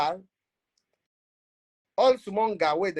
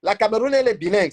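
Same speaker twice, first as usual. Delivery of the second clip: emphatic repeat; 5 semitones higher, 5 dB louder, faster than usual. Shown as a demonstration. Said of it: "Si vous n'avez pas compris".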